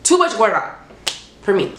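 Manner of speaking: usual speaking voice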